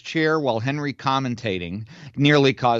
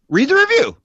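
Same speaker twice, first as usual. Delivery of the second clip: much higher, louder exasperated demand; exasperated shout; 7 dB louder than usual. Real, not acted. Host, fed up, shouts at his cohost to read the review. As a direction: snap the order out in disbelief.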